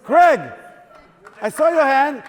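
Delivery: normal talking voice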